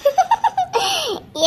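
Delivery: high-pitched burst of laughter; helpless high giggle; mocking laugh. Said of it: "சிரிக்காத".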